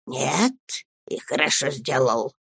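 low, soft voice; whispered menace. A narrator's reading. Headmaster hearing the answer, low and whispering. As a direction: take it in under your breath. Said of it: "мог говорить только шёпотом".

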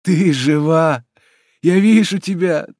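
high, loud, overwhelmed cries; emotional, rising exclamations of joy; trembling voice. A narrator's reading. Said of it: "говорил дрожащим голосом Джальма".

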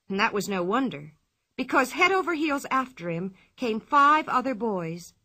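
usual speaking voice

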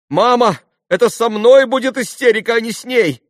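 put-on high, loud girlish outburst; near-hysterical shout. A narrator's reading. Lise warns mother she will be hysterical, shouting high. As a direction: cry out as if about to break into hysterics.

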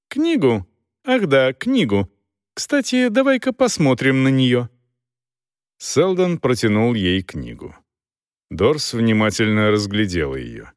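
ordinary delivery

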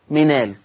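منال pronounced minal, no glottal stop; منال بلا همزة. Shown as a demonstration. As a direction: say منال with the hamza dropped and its vowel carried onto the n. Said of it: "حد سمع مني صوت الهمزة". (منال بنقل حركة الهمزة إلى النون)

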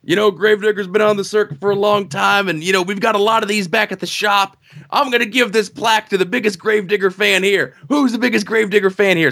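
high, hyped-up truck driver promo; announcer voice. Retold or imitated. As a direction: pump up the crowd like a wrestling promo. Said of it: "So, he's like".

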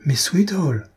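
a touch of startled emotion, pitch lifted; moved exclamation. A narrator's reading. Aristide, grabbed in the dark, exclaims with mild emotion. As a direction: cry out his name, lightly moved. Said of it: "s'exclame-t-il, tout ému".

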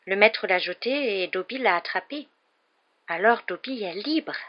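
small elf voice, lilting with wonder; wondering creature with swooping pitch; amazed elf. A narrator's reading. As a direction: realise it bit by bit, amazed. Elf realises he is freed.

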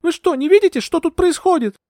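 very high, urgent woman's voice; exclaiming. A narrator's reading. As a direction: demand that he open his eyes. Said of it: "воскликнула я".

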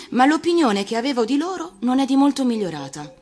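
usual speaking voice

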